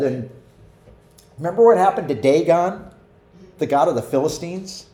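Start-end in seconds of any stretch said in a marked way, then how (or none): none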